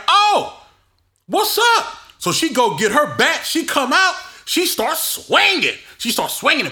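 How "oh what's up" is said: high, loud "what's up" thrown out; sister's mock challenge. Sister confronting the commotion, high loud challenge. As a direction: call them out like the sister did. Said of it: "She like".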